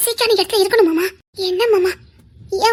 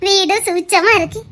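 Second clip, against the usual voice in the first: normal talking voice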